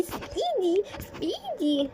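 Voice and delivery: put-on girl screaming at the man holding her arm; shrill panicked plea. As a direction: scream it as she pulls away. Girl dragged away.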